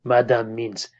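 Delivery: slow French-accented correction, lightly voiced; de Gaulle's slow French accent, ending in a whisper. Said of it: "Charles de Gaulle leans over and says".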